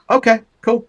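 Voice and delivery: put-on bright, high reply of a stunned boy.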